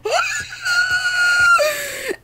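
very high scream-laugh; shrieking laugh. Real, not acted. Susto en juego de terror. (risa chillona de susto)

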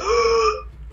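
a high, sharp gasp of shock. Retold or imitated. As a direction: gasp as if a hero has just appeared in front of you. Cosplayer surprises her, panic attack.

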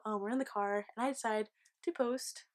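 ordinary talk, nothing marked

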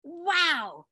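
mock child bursting with joy; excited child. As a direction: gush like an excited little boy. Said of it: "He was saying".

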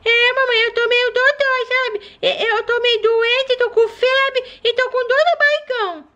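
put-on a sick little girl telling mom her tummy hurts; sick baby voice. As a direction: whimper like a feverish toddler. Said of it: "com essa vozinha meio ruinzinha".

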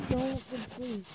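ordinary delivery

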